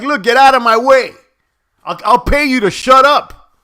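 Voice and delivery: imagined loud retort. Retold or imitated. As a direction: tell her off as if she's right there.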